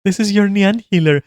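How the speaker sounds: hammed-up perky healer hello; mock bubbly healer. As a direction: squeal it like an overexcited party healer. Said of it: "everyone is like".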